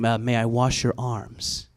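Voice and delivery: lightly voiced serene helper; a hint of hushed respectful politeness; gentle namaste-style offer, understated; mock reverent request. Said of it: "very respectful in this ... namaste kind of way".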